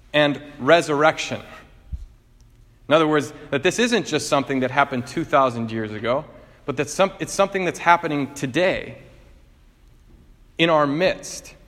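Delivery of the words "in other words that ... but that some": ordinary delivery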